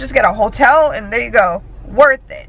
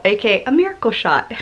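ordinary talk

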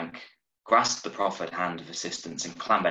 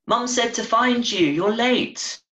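put-on high, bright little girl's voice; little sister calling her brother home, high child voice; 5 dB louder, slower than usual. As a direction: play a small girl fetching her brother. Child Phoebe voiced in story.

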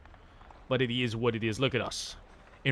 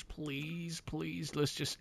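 hushed plea; 14 dB quieter, much slower than usual. Real, not acted. Begging the game quietly.

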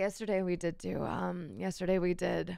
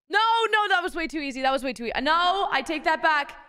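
excited; 7 semitones higher, 7 dB louder than usual. Real, not acted. Excited joking counteroffer.

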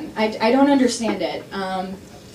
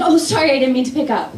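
awkward apology; 5 semitones higher, 4 dB louder than usual. Retold or imitated. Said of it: "you're like".

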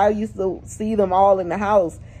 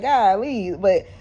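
normal talking voice

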